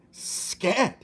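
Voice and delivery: high, loud shouted scat; king shouting at a mouse. A narrator's reading. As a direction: bark it out at the mouse. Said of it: "he shouted".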